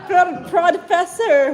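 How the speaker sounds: high, slow moaned cry; loud moan. A narrator's reading. Lala moans loudly to the professor, high and slow. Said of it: "moaned la-, loudly Lala".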